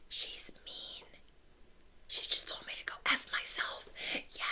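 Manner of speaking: hushed gossiping whisper. Retold or imitated. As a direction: whisper it behind her back. Coworkers gossiping about her.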